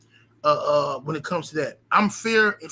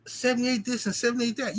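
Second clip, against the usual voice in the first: mock the haters in a sneering whiny voice; mocking whine; 5 semitones higher, 4 dB quieter than usual. Mocking critics who gossip about him, sneering. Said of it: "Goofy bitches, "Oh".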